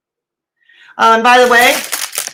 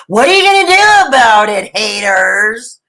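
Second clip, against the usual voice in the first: mock taunt; 3 dB louder than usual. Snacking vlogger to haters, loud playful taunt. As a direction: throw it out boldly, hamming it up.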